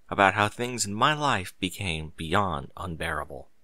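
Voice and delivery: ordinary delivery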